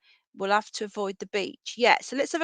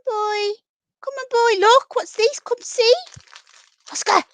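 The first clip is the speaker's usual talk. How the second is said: very high, louder coaxing pet call; treat voice; 11 semitones higher, 8 dB louder than usual. Real, not acted. Coaxing the rabbit over with the high treat voice. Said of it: "this is the treat voice".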